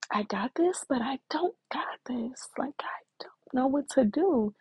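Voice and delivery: high, breathy mock cry; retold tearful distress. Daughter crying on the phone to mom, tearful and helpless. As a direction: relive the helpless crying, voice lifting and thinning. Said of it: "And I'm crying".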